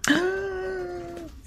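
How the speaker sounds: sharp high gasp of disbelief; shocked gasp. Acted. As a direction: gasp in shock, pitch flying up. Shocked reaction to Steve's return.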